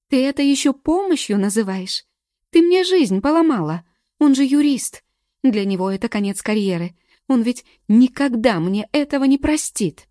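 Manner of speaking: loud, high, furious accusation; angry outburst. A narrator's reading. Galka yelling at Morgen for ruining her life, furious. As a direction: hurl the blame at her friend.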